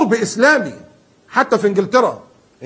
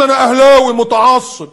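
mock fanatic fan; 7 semitones higher, 9 dB louder, slower than usual. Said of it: "ما انت بتقول".

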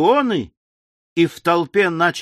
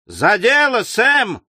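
high, rousing cheer; lively high-pitched call of encouragement; encouraging shout; 7 semitones higher, 3 dB louder, slower than usual. A narrator's reading. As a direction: egg on a fighter from the crowd. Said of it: "поощрительно закричали несколько извозчиков".

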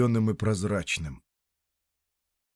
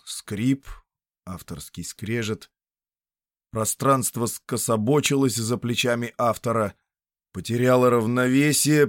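ordinary delivery, nothing marked

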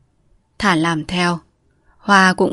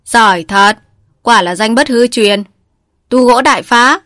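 mocking; 4 semitones higher, 6 dB louder than usual. A narrator's reading. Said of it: "rồi phá ra cười".

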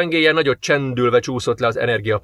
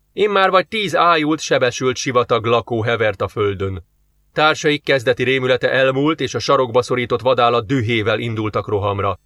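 usual speaking voice

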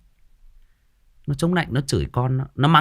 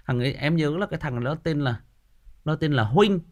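ordinary talk

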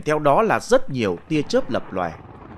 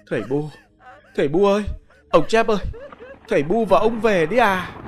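tearful calling out; 5 semitones higher, faster than usual. Acted. Widow calls out to her dead parents-in-law, wailing. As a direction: cry out to the spirits in despair.

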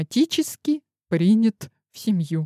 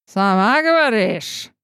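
mocking snort; slower than usual. A narrator's reading. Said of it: "хмыкнула Каракула".

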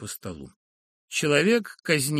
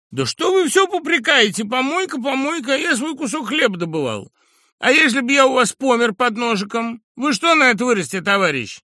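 high, loud, cocky and aggrieved; a brash lout talking back; crude upstart voice; 11 semitones higher, 9 dB louder than usual. Acted. Brash Sharikov talks back to the professor, high and loud.

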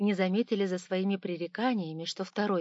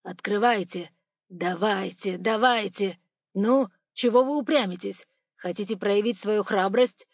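loud, pressing demands; bully someone into obeying; impatient robber's loud orders; threatening; 7 dB louder than usual. A narrator's reading. Robber threatens man at safe.

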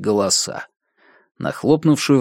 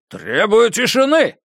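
shouted demand; 10 semitones higher than usual. A narrator's reading. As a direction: shout over the jeering. Colonel demands silence.

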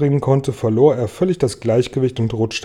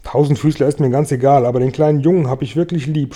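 plain reading voice

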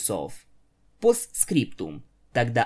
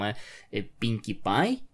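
ordinary delivery, nothing marked

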